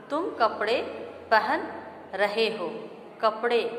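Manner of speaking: कपड़े repeated with a clear falling tune; stress the corrected word. Shown as a demonstration. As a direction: hit कपड़े and say it again firmly at the end. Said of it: "शुद्ध रूप होगा".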